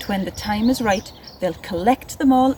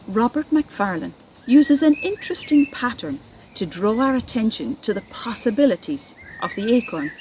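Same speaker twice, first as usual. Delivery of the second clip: ordinary talk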